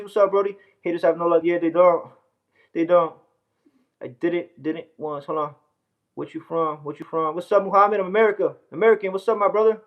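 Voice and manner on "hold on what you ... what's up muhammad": ordinary talk, nothing marked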